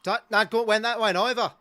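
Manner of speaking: high, loud shouting in alarm; panicked shout. Real, not acted. Fan shouting at the TV in the dying seconds, panicked yell. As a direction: yell as the ball sails over. Tense final seconds of match.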